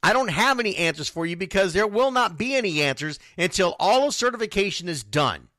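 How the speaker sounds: ordinary delivery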